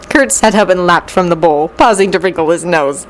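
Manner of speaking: plain reading voice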